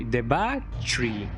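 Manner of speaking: "battery" drawn out slowly as BAA-tree; slow, exaggerated two-part "battery"; split-up battery. Shown as a demonstration. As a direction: stretch the first syllable of "battery", then "tree". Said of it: "Pronunciation, man".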